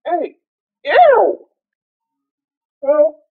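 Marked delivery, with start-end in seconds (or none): 0.8-1.5 high loud disgusted ew. Real, not acted. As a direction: recoil with a loud ew. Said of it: "now I'm spitty".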